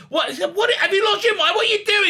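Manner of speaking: high-pitched patronizing scolding; mock an incredulous, condescending telling-off; terrible David Tennant. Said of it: "she's so patronizing".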